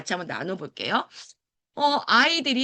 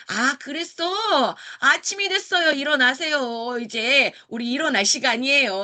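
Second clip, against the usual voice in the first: slow, higher, kind voice joining the game; gentle playful teacher; 4 semitones higher, 4 dB louder than usual. Shown as a demonstration. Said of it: "하면 되는데". (놀이에 맞춰 다정하고 천천히)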